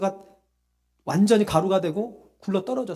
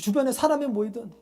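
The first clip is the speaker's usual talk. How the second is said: ordinary talk, nothing marked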